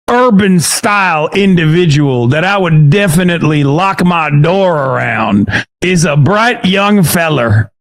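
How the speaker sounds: put-on caricatured good-old-boy accent; mock Klansman accent. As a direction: play a hammed-up Klansman sizing someone up. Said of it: "even though I'm currently a Klansman".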